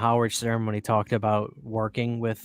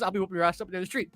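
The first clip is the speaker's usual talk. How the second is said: very fast, higher mock threat; Tarantino threat; 5 semitones higher, 5 dB quieter, much faster than usual. Podcaster retelling Tarantino threatening a cameraman, very fast. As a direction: spit out the threat in a rush. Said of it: "he goes".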